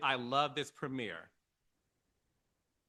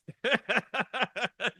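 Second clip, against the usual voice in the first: loud high chuckling; real amused cackle; laugh; 8 semitones higher, 6 dB louder than usual. Real, not acted. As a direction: laugh out in a quick run of pulses. Laughs at own story.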